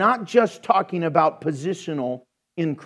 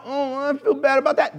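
mock guilty conscience; 7 semitones higher than usual. Imagined churchgoer whose conscience pricks after slang, mock-guilty. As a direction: act out a pang of guilt.

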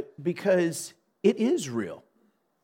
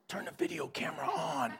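whispered secret instruction, much softer; hushed aside; 13 dB quieter than usual. Retold or imitated. Telling brother-in-law to secretly film, whispering. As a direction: slip it to an accomplice under your breath.

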